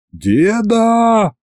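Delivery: high call down to grandpa far below; boy on the mammoth calls down to grandpa. A narrator's reading. High yell downward. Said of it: "заорал я крохотной фигурке".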